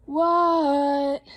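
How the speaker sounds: loud, drawn-out yell back; long, stretched storybook shout; shouted reply. Acted. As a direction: holler it back across the house. Shouting back to calling Dad.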